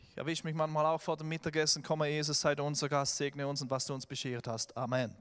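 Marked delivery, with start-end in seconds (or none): 1.8-5.2 mechanical grace before a meal; hungry father droning the table grace by rote; rattled-off prayer. Retold or imitated. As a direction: pray it like a routine to get to the food. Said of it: "einfach Dinge runterzuleiern".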